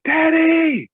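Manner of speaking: high, loud cry for Dad; imitated daughter's scream. Daughter screams for her father, high imitated yell. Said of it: "screams my name".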